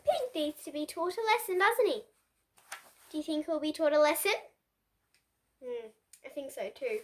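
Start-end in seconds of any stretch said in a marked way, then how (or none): none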